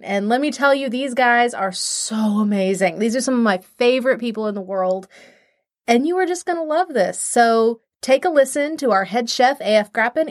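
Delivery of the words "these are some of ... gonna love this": usual speaking voice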